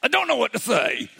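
high-pitched mock-helpless wail; helpless exasperation. Husband at a loss before crying wife, mock-helpless high voice. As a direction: jump up high, flustered and lost.

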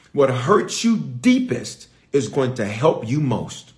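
each word laid down slowly; slow it down so it sinks in; slow, weighty delivery. Shown as a demonstration. Preacher to church, slow and deliberate. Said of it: "can I give you another line".

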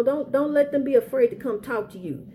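normal talking voice

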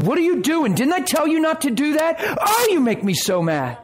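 hammed-up angry father yelling; mock angry scolding. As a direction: imitate a harsh scolding parent. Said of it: "He's not coming in and saying".

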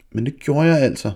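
put-on girl defying her mother; defiant. A narrator's reading. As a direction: dig in and insist. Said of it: "sagde Lise trodsigt".

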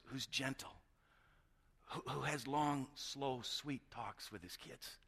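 slow down and soften, dwelling on each word; gentle. Retold or imitated. Preacher reading a father's wish for slow talks with kids, gently.

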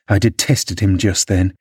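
ordinary delivery, nothing marked

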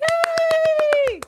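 high, cheering hey; mock crowd cheer. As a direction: shout it like a delighted crowd.

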